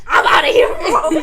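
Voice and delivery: loud yell through laughter; mock fleeing yell. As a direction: yell it as a comic getaway. Acting out her running away.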